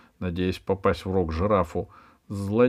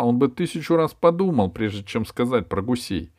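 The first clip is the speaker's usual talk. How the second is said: plain reading voice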